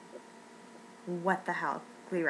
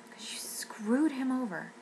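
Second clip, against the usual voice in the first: accusing whisper; 4 semitones higher than usual. Real, not acted. Accusing the show's creator.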